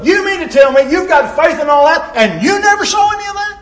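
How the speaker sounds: rising, disbelieving challenge; scoffing skeptic. Retold or imitated. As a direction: needle them with disbelief. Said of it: "people look at us and go".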